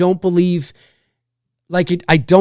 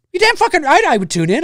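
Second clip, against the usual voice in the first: excited; 10 semitones higher, faster than usual. Real, not acted. Eager to watch AI movie.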